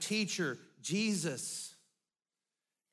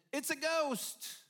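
frightened cry; 8 semitones higher, slower than usual. Retold or imitated. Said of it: "it says the disciples said".

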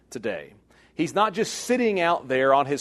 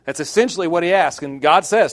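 usual speaking voice

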